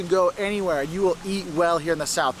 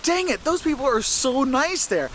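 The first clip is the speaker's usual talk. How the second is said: higher, slower gushing quote of a delighted visitor; high-pitched retold enthusiasm; retold gushing praise; 7 semitones higher than usual. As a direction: gush with drawn-out emphasis, as if quoting her. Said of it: "She's like".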